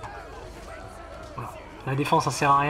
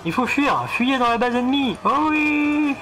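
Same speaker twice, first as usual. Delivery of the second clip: high sarcastic troop voice; mock the dumb soldiers; 7 semitones higher than usual. Mocking the fleeing troops.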